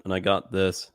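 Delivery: ordinary talk, nothing marked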